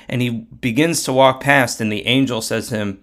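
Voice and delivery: plain reading voice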